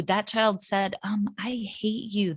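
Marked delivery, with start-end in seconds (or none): 1.4-2.3 retold angry teen, whispered; imitating a sulky teen in a whisper; mock hissed whisper. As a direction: whisper the hate, not shouted. Teen's retold angry outburst.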